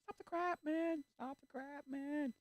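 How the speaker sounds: very high, squeaky-pitched protest; exasperated outcry. Acted. Exasperated at waffling.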